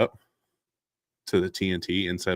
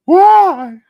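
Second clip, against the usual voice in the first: kids' tearful "why", hammed up; imitating a bawling child; mock crying wail; 16 dB louder than usual. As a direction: wail it very high and loud. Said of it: "my children will be crying".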